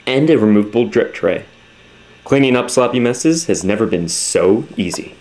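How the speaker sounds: loud pushy product pitch; hard sell ad. Acted.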